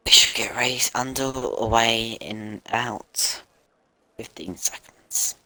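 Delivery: hushed whisper, slowed down. Acted. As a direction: breathe the countdown in a slow whisper. Hushed countdown before race start.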